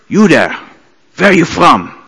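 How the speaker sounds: single someone out like a hard German conductor; stern German conductor. Retold or imitated. Conductor halting rehearsal to point at a musician, lightly imitated stern German. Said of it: "a world-famous German conductor come".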